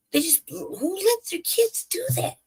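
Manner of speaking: whispered, slowed, close to crying; whispered disbelief. Real, not acted. Asking viewers who lets kids beg, whispered. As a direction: breathe it out in disbelief. Outraged at the kids' parents.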